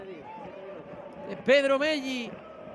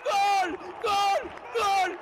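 high shouted goal cries; goal celebration; 7 semitones higher, much slower than usual. Real, not acted. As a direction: scream the winner out.

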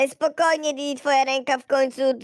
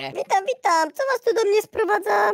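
doctor greeting; 10 semitones higher than usual. Acted. Doctor character greets patients.

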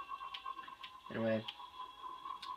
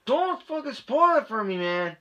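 annoyed outburst; 8 semitones higher than usual. Real, not acted. Friend spoiling game reward.

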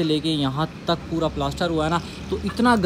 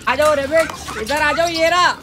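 calling out; 9 semitones higher, 5 dB louder than usual. Real, not acted. Calling the rabbit over.

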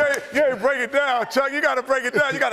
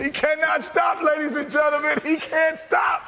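announcer shout; 6 semitones higher than usual. Real, not acted. Hyping Chuck's dancing to the audience.

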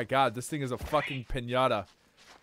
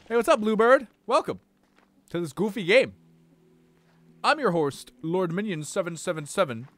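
bright, loud, higher showman intro; hammed-up host voice; 3 semitones higher, 6 dB louder than usual. Streamer greeting Bluebird as a showman host. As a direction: welcome them like a game show host.

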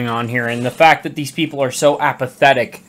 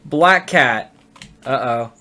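ordinary delivery

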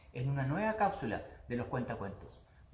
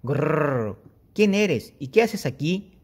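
slow growling dragon, pitched up; hoard-guarding dragon; 13 dB louder, much slower than usual. Acted. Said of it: "gruñó el dragón".

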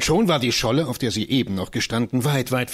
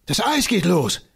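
much higher, ringing shout of discovery; excited cry; 10 semitones higher than usual. Acted. Johannes shouts to Christiane as the ice breaks, excited. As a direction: cry out in thrilled alarm.

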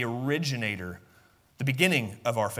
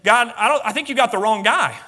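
lightly acted, raised-pitch objection; protesting Moses; 8 semitones higher, 8 dB louder than usual. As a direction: beg off the job, a bit high and loud. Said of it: "for Moses to look at him and say".

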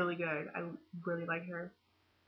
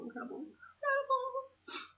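"incredible" pitched much higher, stretched out slowly in an overwhelmed, near-crying gush; gushing squeal; 3 dB quieter than usual. Real, not acted. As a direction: let "incredible" soar up high and drawn out, almost in tears.

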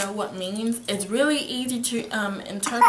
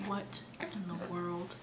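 very soft, slow, lower-pitched disbelief; stunned murmur; 4 semitones lower, 16 dB quieter, slower than usual. Retold or imitated. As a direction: say it slowly under your breath, as if just woken. Acts out waking from the dream.

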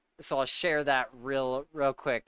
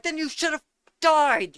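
higher, louder cold verdict; stern Sirius; 13 semitones higher, 5 dB louder than usual. Retold or imitated. Quoting Sirius condemning Peter, hammed-up sternness. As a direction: pass sentence coldly. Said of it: "And Sirius like".

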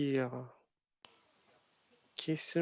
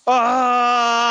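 sudden high scared yelp; startled scream; 8 semitones higher, 11 dB louder than usual. Real, not acted. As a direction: shriek in fright. Monster catches him in game.

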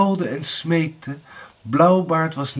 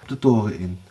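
plain reading voice

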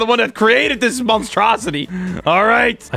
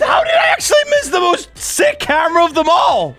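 astonished; 11 semitones higher than usual. Real, not acted. Gamer realizes he missed the best camera, astonished shout. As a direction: burst out in amazed disbelief.

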